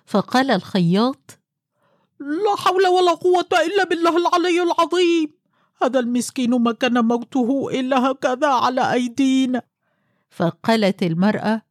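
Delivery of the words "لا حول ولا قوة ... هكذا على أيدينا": high, fretful man's lament; distressed tailor voice. Acted. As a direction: wring your hands over the dead man. Tailor lamenting the dead hunchback.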